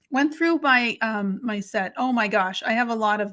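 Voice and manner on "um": ordinary talk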